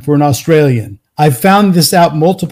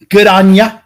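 mock Australian, pitched up a touch; Australian accent; 3 semitones higher, 4 dB louder than usual. Host imitating an Aussie catchphrase, lightly accented. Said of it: "as [name] would say".

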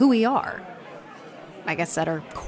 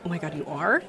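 usual speaking voice

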